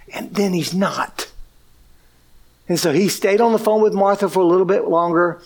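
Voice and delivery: normal talking voice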